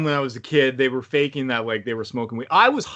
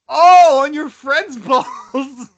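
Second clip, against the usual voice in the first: loud, high "oh" breaking into laughter; shocked realisation; 11 semitones higher, 8 dB louder, much slower than usual. Real, not acted. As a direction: burst out in amused horror, drawn out. Shocked on learning who got hurt.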